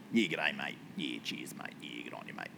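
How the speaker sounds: low, quiet builder mumble. Acted. Preacher imitating ordinary regular builders, low builder voice. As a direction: drop right down into a laid-back builder. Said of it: "That's my, like, builder kind of voice".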